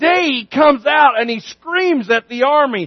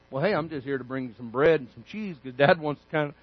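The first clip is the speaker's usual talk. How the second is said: much softer, lower and fast; sheepish David; 9 semitones lower, 12 dB quieter, faster than usual. Acted. Young David shrugs off his angry brothers, quick soft mumble. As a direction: mumble it like an awkward kid brother.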